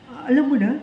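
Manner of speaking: doctor retold, surprised the patient already knows. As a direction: imitate the doctor caught off guard. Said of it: "Tapos siya yung nagulat".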